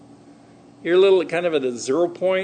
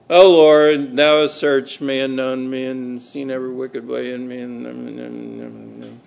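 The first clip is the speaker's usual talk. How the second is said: flatlined recitation; 4 semitones lower than usual. Acted. Preacher mocking a lifeless Psalm reading, flatlined drone. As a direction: drone it on one note, fading away to nothing.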